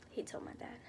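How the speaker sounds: quick whispered tag; whispered aside. Real, not acted. Naming who the bet was aimed at, whispered. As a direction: slip it in under the breath. Aside after quoting friend.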